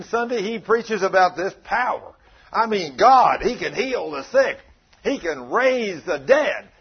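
loud, high preaching with "He can raise the dead" slowed right down; hollering a revival sermon; fiery preaching. Acted. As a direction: preach it full force to the church. Retells the pastor's fiery sermon.

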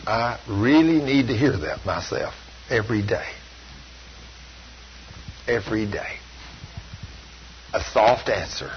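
hushed, humble aside to the congregation; quiet confession. Real, not acted. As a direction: murmur it as a personal admission. Confessing his own harshness.

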